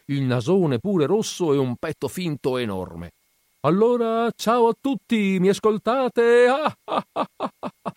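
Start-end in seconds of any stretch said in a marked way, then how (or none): 3.6-6.5 high, loud, slow clown call. A narrator's reading. Clown bursts in, greets all. 6.5-7.9 high, bubbling laugh; a high, infectious cackle; bubbly clown laugh. A narrator's reading. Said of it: "La sua risata era zampillante, contagiosa".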